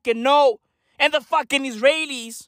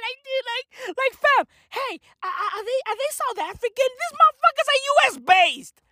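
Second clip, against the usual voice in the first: high incredulity; 12 semitones higher than usual. Acted.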